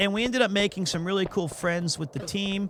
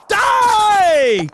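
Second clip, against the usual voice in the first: high, loud battle yell; shout; 14 semitones higher, 11 dB louder than usual. Real, not acted. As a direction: scream it at the enemy. Yelling at the game.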